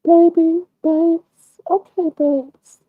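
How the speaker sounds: cooing woman. Retold or imitated. Imitating a woman cooing pet names at Lemon, high and sweet.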